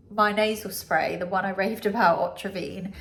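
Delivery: ordinary talk, nothing marked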